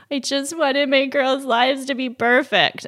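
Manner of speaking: a hint of a crying mother's plea; imitating weeping mother. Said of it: "my mother starts weeping".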